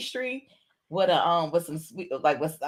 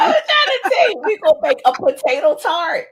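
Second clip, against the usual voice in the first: excited pitch; 9 semitones higher, 7 dB louder than usual. Real, not acted. Pitching her potato tart idea.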